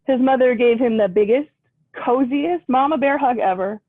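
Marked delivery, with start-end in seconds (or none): none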